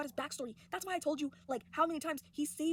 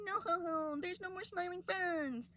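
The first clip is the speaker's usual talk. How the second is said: drawn-out, higher mock wail; mock crying; 6 semitones higher, much slower than usual. Boss mourning his lost employees in a slow mock wail. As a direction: ham up a slow, grieving whimper. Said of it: "and he cries".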